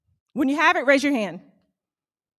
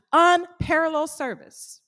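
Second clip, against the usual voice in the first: ordinary talk, nothing marked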